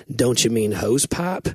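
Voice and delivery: slow, lightly British "hose pipe?" with a rise; imitating her British "Don't you mean hose pipe?"; mock British accent. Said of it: "she said it like that".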